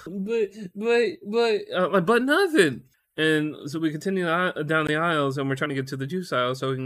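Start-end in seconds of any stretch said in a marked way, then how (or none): 0.1-2.8 high, halting stammered objection; sputter, then shut it down; mock sputtering protest. Couple bickering over a pun, high mock sputter.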